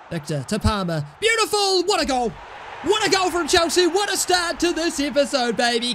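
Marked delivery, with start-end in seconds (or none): 1.2-6.0 long goal yell. Real, not acted. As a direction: belt out the goal call. Goal scored in FIFA.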